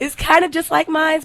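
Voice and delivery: excited. Real, not acted. Excited about her sister.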